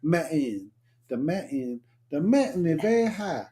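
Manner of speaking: "mountain" with the T swallowed; swallowed T's. Shown as a demonstration. Showing a co-host the accent, "mountain" without its T. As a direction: drop the T in "Mountain" to a catch in the throat. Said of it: "they call it swallowing the teeth".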